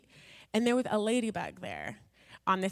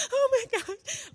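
high, squeaky, near-tearful "oh my gosh"; mock overwhelmed self; 4 dB louder, slower than usual. Mocking her overwhelmed past self, in a high teary squeak. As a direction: play a frazzled basket case at her wits' end.

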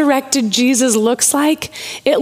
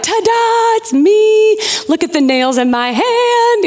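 mock showman's ta-da, pitched high; dramatic reveal; 8 semitones higher than usual. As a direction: announce yourself with playful fanfare. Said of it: "dramatic reveal".

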